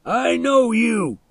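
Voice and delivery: high, drawn-out angry yell; shouted. A narrator's reading. Milo yells at the boy across the fence, shouting. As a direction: yell it slowly at a high pitch. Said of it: "he shouted".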